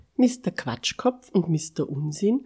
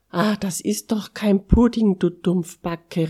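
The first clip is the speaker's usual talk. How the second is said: higher, louder scolding of a friend; exasperated calling out; 5 semitones higher, 7 dB louder than usual. A narrator's reading. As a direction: call it out, correcting a silly friend. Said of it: "rief Mister Quatschkopf". (empört ausrufen)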